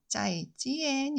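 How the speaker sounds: 짜이지엔 as zaijian, tones shaped; 짜이지엔 said clearly for repeating; Mandarin pronunciation. Shown as a demonstration. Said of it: "따라서 한번 말씀해 보세요". (따라 하라고 하는 짜이지엔)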